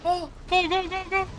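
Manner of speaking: high-pitched, urgent go-go-go; urgent urging. Real, not acted. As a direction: urge teammates on in a high, rapid-fire repeat. Urging teammates on during play.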